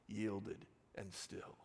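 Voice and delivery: slow, low and soft; hushed echo. Real, not acted. Preacher echoing the hymn's last line, hushed. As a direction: let the hymn's words settle quietly. Echoes hymn's closing words.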